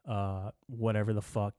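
ordinary talk

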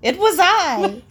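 put-on high, loud, drawn-out kid proclamation; mock a child's pompous confession; imitated little kid declaring grandly; seven-year-old's voice. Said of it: "my stupid seven-year-old voice intrudes".

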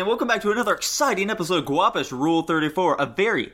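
big game show host intro; announcer voice. Acted. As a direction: hype up the show's opening. Host opening a show episode.

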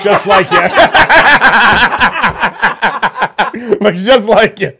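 laughing through mocking words; laughing tease. Real, not acted. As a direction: crack up while teasing him. Teasing a friend's lookalike pick.

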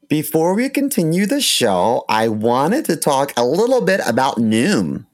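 normal talking voice

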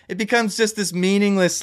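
ordinary delivery

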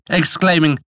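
plain reading voice

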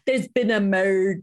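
a light mock Glasgow detective; hint of a Scottish TV cop; playful Scottish catchphrase impression; Scottish accent. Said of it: "now I'm gonna alienate our Scottish fans".

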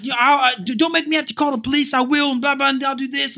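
high, agitated retold warning; worked-up re-enactment, pitched high; pumped-up threat. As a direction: rattle off a threat with adrenaline up. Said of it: "I was pumped".

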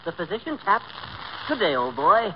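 mock-British gentleman greeting a guest; amateur British old-boy accent; affected British accent. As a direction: put on a Bond Street brogue. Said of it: "this Bond Street brogue".

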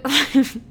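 cracking up mid-sentence; real laugh. Real, not acted. Joking about her sister, cracks up. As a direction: break off into a laugh.

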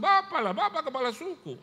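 put-on very high, loud Papuan-accented answer; imitated Papuan accent. Locals tell the pastor he is chief, high loud Papuan accent. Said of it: "Saya pernah ke Papua".

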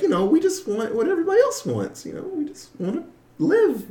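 high-pitched interviewee voice, lightly acted; lightly put-on, lifted character voice; Brainy Gremlin. Gremlin answering an interviewer, high put-on voice. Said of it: "I wrote Brainy Gremlin. Uh, in the interview, when he's like".

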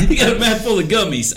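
high laughing correction. Real, not acted. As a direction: giggle through the words. Laughing correcting his joke.

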